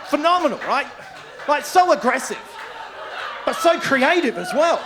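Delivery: loud and high, laughing in awe; amazed. Real, not acted. Telling the crowd about his mate's stunt, loud laughing awe. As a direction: rave about it, cracking up.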